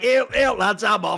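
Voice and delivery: mock northern English farmer calling to the lads; hammed-up flat-cap northern English voice; northern English countryman. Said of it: "had a flat cap and a little crook".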